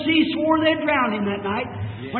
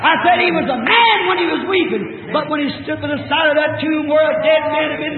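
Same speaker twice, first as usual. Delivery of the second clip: rising, louder preacher's cry; preaching at full force; 4 semitones higher, 3 dB louder than usual. Acted. Preacher arguing Christ's divinity to his church, at full force. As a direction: build it up and ring it out over the congregation.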